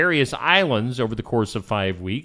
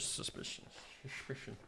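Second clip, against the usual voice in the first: whispered echo; 27 dB quieter than usual. Pretend. Playful echo of suspicion.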